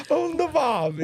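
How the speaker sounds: put-on very high, laughing Australian accent; mock Australian accent. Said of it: "trying to talk like an Australian".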